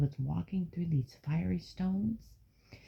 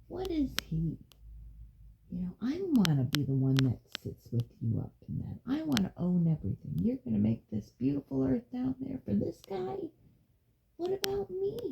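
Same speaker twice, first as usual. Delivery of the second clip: a hint of a sulky, jealous angel; lightly voiced angry Lucifer; 6 semitones higher than usual. Lucifer complaining to God in court, mildly sulky.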